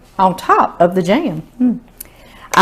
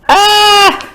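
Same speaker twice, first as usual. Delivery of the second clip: delighted gasp; 11 semitones higher than usual. Real, not acted.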